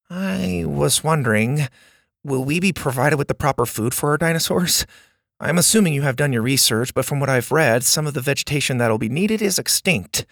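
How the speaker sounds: put-on fast, earnest know-it-all kid; eager nerdy boy. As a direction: rattle it off like a keen student. Bo asks quickly.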